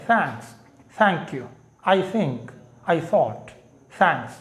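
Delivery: British English th sound. Shown as a demonstration. Teacher demonstrates to students the English th, soft and light. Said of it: "America और बरतानिया में इसको स पढ़ते हैं".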